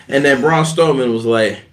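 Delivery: ordinary talk, nothing marked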